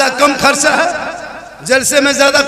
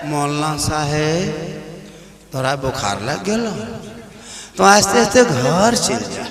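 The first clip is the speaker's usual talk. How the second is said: acted villager's concern; 9 semitones lower, 4 dB quieter than usual. Villager telling the feverish imam to go home, acted concern. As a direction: draw it out low, playing the solicitous villager.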